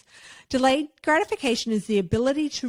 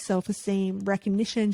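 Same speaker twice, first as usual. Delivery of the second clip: normal talking voice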